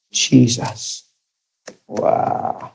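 near-whispered reverent wonder; hushed awe. Real, not acted. Preacher marveling at seeing Jesus, hushed awe. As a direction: let the voice drop to a soft, awed breath.

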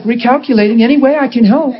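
high-pitched, syrupy imitation of a GPS; sweet, gracious GPS lady. GPS lady forgiving a driver's wrong turn, sweet and gracious. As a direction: play an endlessly patient navigator, pitched well up. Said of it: "She is so sweet. She's like".

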